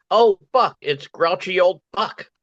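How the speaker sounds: high-pitched mock alarm at a superhero; mock onlooker exclamation. Bystander spots the superhero, high mock-startled cry. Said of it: "As in".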